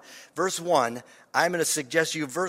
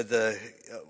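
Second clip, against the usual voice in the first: usual speaking voice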